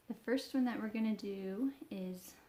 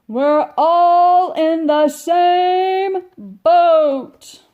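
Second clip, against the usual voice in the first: long, slow, loud holler, higher; 8 semitones higher, 24 dB louder, much slower than usual. Acted. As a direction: bellow it out slowly to the whole ark. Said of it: "Noah took a deep breath and hollered".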